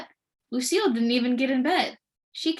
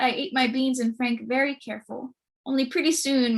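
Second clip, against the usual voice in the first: ordinary delivery, nothing marked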